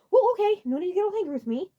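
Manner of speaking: high, loud placating; flustered pleading; nervous. A narrator's reading. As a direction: back off nervously. Abrak hands up in fright.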